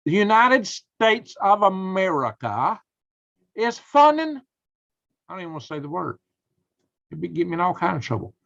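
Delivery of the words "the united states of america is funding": slow, loud, rising in disbelief; outraged reveal. Real, not acted. Telling viewers the country funds the lab, slow outraged build. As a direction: drag it out, then go high on funding.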